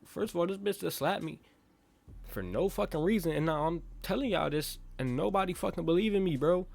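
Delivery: indignant rant climbing in pitch; getting mad. Retold or imitated. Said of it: "I'm getting mad".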